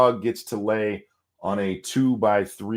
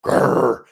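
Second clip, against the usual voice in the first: loud rolling growl; short warning snarl like a dog; imitated dog snarl; 6 dB louder than usual. Dog warning off the gas man, loud growl. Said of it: "Caesar went".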